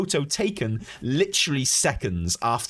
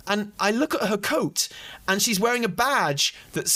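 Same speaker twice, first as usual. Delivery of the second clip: ordinary delivery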